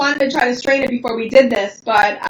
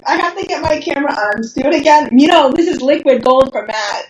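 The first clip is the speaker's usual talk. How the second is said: usual speaking voice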